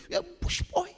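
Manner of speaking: high, teasing put-on voice; mocking taunt. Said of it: "I said".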